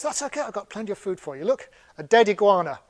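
playful jackal voice, building into laughter; eager sneaky jackal. Acted. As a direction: pitch it brightly like a pushy salesman. Sneaky jackal offers food eagerly.

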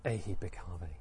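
low, soft call; quiet invitation. Shown as a demonstration. Teacher trying how the Buddha ordained him, quiet invitation. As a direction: say it softly and low. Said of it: "how did he say them".

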